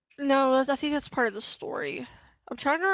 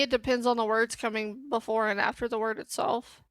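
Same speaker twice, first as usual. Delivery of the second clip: ordinary delivery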